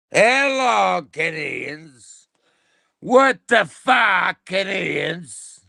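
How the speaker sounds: high, booming voice of God, shouting; Jesus greeting. Acted. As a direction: play Jesus calling down from above, outraged. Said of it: "Jesus of God says".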